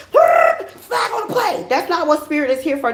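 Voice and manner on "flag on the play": high, slow, drawn-out referee call; mock referee call. Spirits pictured as referees, mock drawn-out ref call. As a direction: throw the flag like a mocking ref. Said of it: "They're not here to referee us".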